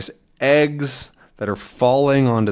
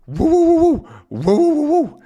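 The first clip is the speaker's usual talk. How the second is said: high, loud yelping dog bark; 5 dB louder than usual. Acted. Snowy barking to warn the firemen, high and loud. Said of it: "That's my dog bark".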